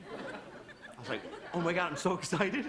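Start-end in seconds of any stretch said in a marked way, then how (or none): 1.5-2.7 mock excitement. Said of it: "'Cause I get to be really mad".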